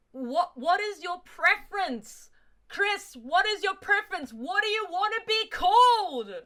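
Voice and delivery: loud, high, drawn-out pleading; over-the-top exasperated yell; exasperated demand. Real, not acted. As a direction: wail the question in mock despair. Exaggerated demand to a character.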